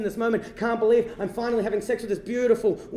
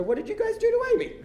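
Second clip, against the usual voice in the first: high-pitched bewildered question; 13 semitones higher than usual. Retold or imitated. Son confronting his parents over his crying girlfriend, high and baffled. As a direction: voice shoots up high in mock alarm. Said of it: "and just go".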